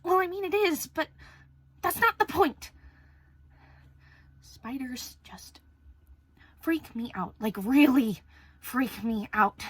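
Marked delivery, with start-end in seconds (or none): none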